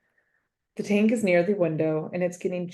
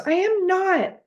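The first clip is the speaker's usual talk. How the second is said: much higher, defiant shout; shouting back; 4 dB louder, slower than usual. Acted. A girl shouts back at her accusing friend. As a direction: fling it right back at her. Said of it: "The other student shouts".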